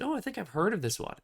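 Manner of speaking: high, quick, lightly surprised; mock recognition. The guy's friend spotting Rune at the booth, high and quick. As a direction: perk up as if it rings a bell.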